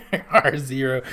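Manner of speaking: laughing through the words. Real, not acted. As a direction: giggle while confirming it. Result confirmed, laughs at it.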